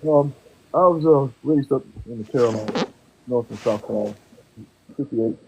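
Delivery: muffled, too far from the mic. Real, not acted. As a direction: voice dulled away from the mic. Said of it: "You sound muffled".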